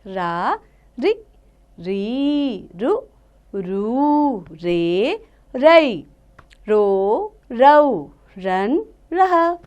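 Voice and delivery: slow, drawn-out teacher's chant of each syllable; slow syllable recitation. Shown as a demonstration. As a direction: sound out every syllable slowly for children. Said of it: "अब हम पढ़ेंगे र की मात्राएं". (धीमे-धीमे अक्षरों का उच्चारण)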